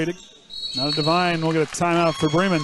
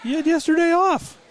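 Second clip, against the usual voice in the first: teasing disbelief; 11 semitones higher than usual. Real, not acted. Teasing a tired co-host.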